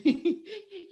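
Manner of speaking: light high giggle; a quick amused laugh. Real, not acted. Laughing at Brandon.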